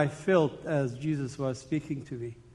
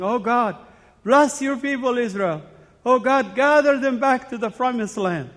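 raised, louder pleading prayer voice; fervent prayer; 7 semitones higher, 5 dB louder than usual. Retold or imitated. A man in his bedroom, praying aloud in sudden fervent prayer. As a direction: cry it out to God, lifted high.

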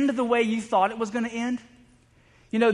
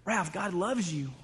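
hushed and slow, softer; retold whisper; 2 semitones lower, 7 dB quieter, slower than usual. Pastor to dying friend in a coma, hushed. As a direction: gently murmur it close to the ear. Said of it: "I just remember whispering in his ear".

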